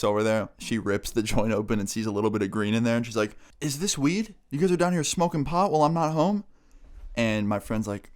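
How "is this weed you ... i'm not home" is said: high, indignant mom voice; accusing mom. Retold or imitated. Mom finding green in the joint, accusing mom. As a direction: imitate a mom demanding answers.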